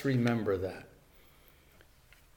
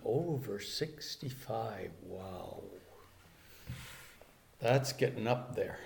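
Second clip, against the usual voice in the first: quiet inner thought; 4 semitones lower, 10 dB quieter than usual. Retold or imitated. Remembered boyhood private thought.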